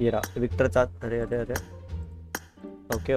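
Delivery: ordinary delivery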